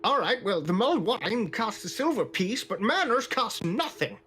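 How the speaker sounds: put-on high, tart old woman's rebuke; surly old barmaid. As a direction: lecture them on manners, high. [name] scolds the rude customer.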